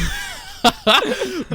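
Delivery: a high burst of laughter; high laughing burst; big laugh. Real, not acted. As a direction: crack up at yourself. Laughing at his own story.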